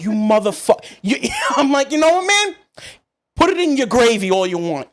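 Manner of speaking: high, loud mock outrage at the butcher; hammed-up fury, pitch flying up; half-laughing mock rant; mock outburst. Heated retort to the butcher.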